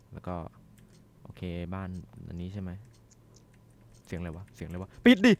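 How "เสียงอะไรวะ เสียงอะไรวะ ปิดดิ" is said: louder panicked yell to shut the door; startled panic yell; panicked shout. Real, not acted. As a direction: yell it in a jump scare. Scared by a sudden sound.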